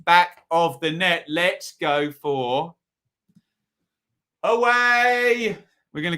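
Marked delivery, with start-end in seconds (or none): 4.4-5.6 high, loud drawn-out call; game-show reveal. Real, not acted. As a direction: announce the pick with a flourish. Announcing his pick.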